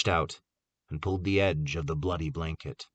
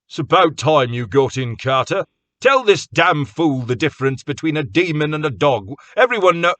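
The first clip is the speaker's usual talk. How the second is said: heated; 8 semitones higher, 9 dB louder than usual. A narrator's reading. Jake's heated argument, cut off.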